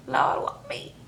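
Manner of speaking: breathy whispered plea; whispered mock lament. Mama despairing over her daughter, whispered mock lament. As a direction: whisper it like a broken prayer. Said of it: "mama gets to crying".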